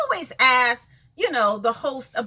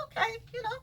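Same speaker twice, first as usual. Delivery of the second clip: 1 mock sweet dismissal; 9 semitones higher, 5 dB quieter than usual. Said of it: "And I was like".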